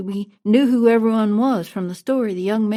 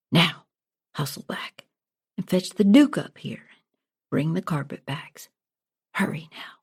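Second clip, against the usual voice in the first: hushed urgent whisper; whispered order; 4 semitones lower, 3 dB quieter than usual. A narrator's reading. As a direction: whisper it so no one hears. King sends boy secretly for duke.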